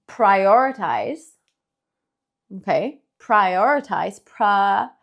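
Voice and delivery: "Prioritize" drawn out long and slow; stretched out slowly. Shown as a demonstration. Teacher stretching the word for students, slow and drawn out. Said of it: "Lo alargamos".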